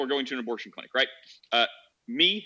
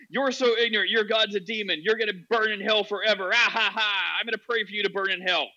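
raised pitch, hammed-up gloating; mock hateful zealot; 6 semitones higher, 4 dB louder than usual. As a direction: imitate a zealot crowing over someone's damnation, mock laugh included.

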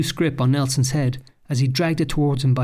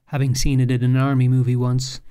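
ordinary delivery, nothing marked

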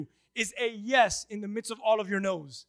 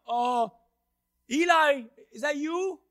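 put-on high-pitched, questioning boy's voice; young Samuel waking; 5 semitones higher than usual. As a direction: call out higher, unsure who spoke.

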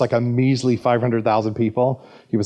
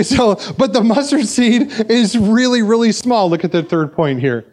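ordinary talk

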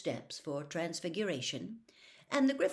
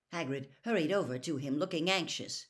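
usual speaking voice

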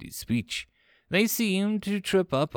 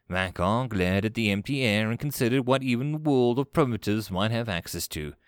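usual speaking voice

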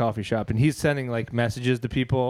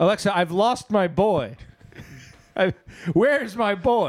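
raised, hammed-up distress; mock frantic parent; 5 semitones higher than usual. Parent to Alexa, lost my boy, mock frantic. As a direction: plead for your missing boy, playfully overdone.

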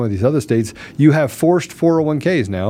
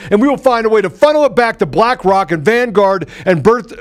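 raised, mocking voice of the powerful; mocking the elites, pitched up and hammed; 8 semitones higher than usual. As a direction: sneer it like a smug elite scolding you.

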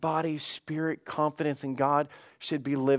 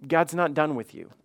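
ordinary delivery

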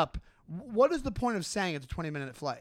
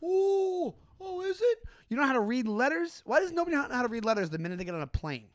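much higher, fake-polite surprise; polite Midwestern mock; 6 semitones higher than usual. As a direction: sweetly play dumb. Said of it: "the polite Midwestern thing".

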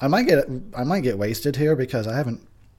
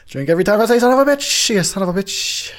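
loud; 8 semitones higher, 6 dB louder, faster than usual. Retold or imitated. Quoting, making a drinking game.

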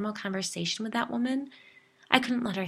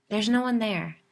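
louder, faked irritation hiding fear; mock annoyance; 4 semitones higher, 8 dB louder than usual. A narrator's reading. Narrator hiding terror from Jill, fake annoyance. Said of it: "pretending to be annoyed".

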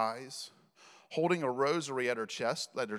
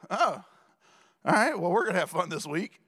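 loud, wry, sarcastic acceptance; sarcastic resolve; 4 semitones higher, 5 dB louder than usual. Retold or imitated. Dad reacting to occult books, wryly loud. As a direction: announce a challenge with a dry edge. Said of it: "That was my attitude".

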